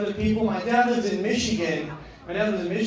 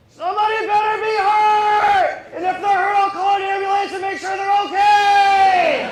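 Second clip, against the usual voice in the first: high, loud, slow hammed-up yell; mock caring shout; 12 semitones higher, 7 dB louder than usual. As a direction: shout the concern like an overwrought hippie. Caring hippie driver in traffic.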